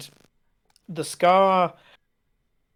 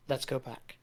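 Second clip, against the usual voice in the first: soft plea; 10 dB quieter than usual. Acted. Ola speaks softly in vision.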